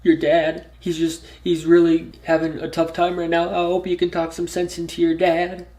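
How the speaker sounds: a lightly voiced tearful woman pleading at the garage door; imitating crying ex-girlfriend. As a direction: voice her begging the kid to help. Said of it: "She was just like".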